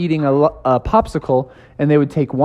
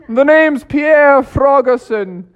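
higher, put-on posh alias voice; disguised frog; 10 semitones higher, slower than usual. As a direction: introduce yourself as Pierre.

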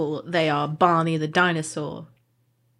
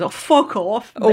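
lightly voiced football hooligan; mock a football hooligan telling someone off; a hint of hooligan swearing; 3 semitones higher, 3 dB louder than usual. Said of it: "like football hooligans".

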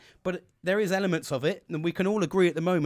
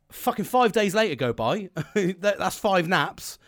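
ordinary talk

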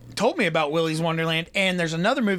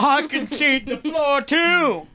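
higher, theatrical Southern lawyer drawl; Southern lawyer accent; 7 semitones higher, slower than usual. Pretend. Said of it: "my Southern lawyer accent".

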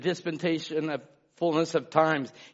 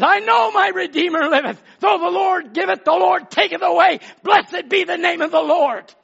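high, loud triumphant declaration; Job's triumphant proclamation; 10 semitones higher, 6 dB louder than usual. Retold or imitated. Job answering his trials, a loud triumphant proclamation. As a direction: lift it up like a man who won't break.

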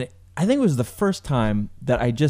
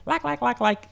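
high, squawky mock chatter; mocking high-pitched gabble; grating voice; 10 semitones higher, 5 dB quieter than usual. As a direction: imitate a girl yapping on and on. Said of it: "she had that grating voice".

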